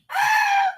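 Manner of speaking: sudden high-pitched cry; high scream. Pretend. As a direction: let out a quick high shriek.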